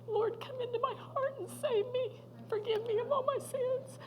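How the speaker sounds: high, choked-up retelling of a plea; tearful confession. Retold or imitated. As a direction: plead through tears as the father did. Retells father's tearful confession and prayer.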